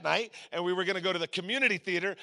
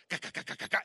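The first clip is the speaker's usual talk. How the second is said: soft, toneless rapid clicking heartbeat; quick quiet "ka" pulses; imitated heartbeat; 6 dB quieter, much faster than usual. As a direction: mimic a racing heart softly. Imitates pounding heart before jump.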